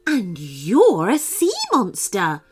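amazed beach woman. Acted. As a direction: savour each word. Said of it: "she boomed".

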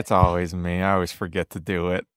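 low, soft, resigned grumble; glum. Acted. Worker who lost nose goes, glum resignation. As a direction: sink low and quiet in defeat.

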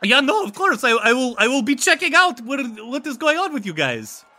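high-pitched, hurried vampire goodbye; friendly vampire. Acted. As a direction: call back cheerily while being dragged off. Ivan keeps saying goodbye.

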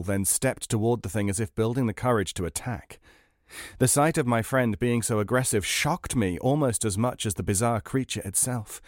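ordinary delivery

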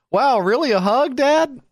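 put-on high, sarcastic kid voice; mock kid. Kids answering dad's offered hug, high mock sarcasm. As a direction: tease dad like an unimpressed child.

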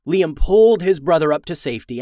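plain reading voice

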